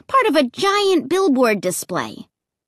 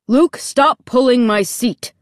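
irritated dad's sharp order; annoyed parental scolding; snapping; 2 semitones higher, 4 dB louder than usual. A narrator's reading. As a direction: snap at the kid behind you. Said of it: "he snapped".

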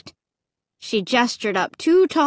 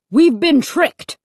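high, loud outrage; angry cry; 6 semitones higher, 6 dB louder, slower than usual. A narrator's reading. Dad realizing the family was tricked, angry. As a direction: cry out at being cheated. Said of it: "I heard Dad cry angrily".

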